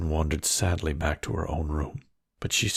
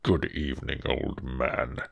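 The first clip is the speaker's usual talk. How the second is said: low, terror-striking beast greeting; terrifying beast; 6 semitones lower than usual. Acted. Beast greets the trembling merchant, low and menacing. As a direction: greet the old man like a looming monster. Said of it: "in a tone that might have struck terror".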